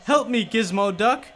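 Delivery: high, pleading mock yelp; acted yelp. Gyro begging Gizmo Duck for help, hammed-up yelp. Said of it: "he yelped".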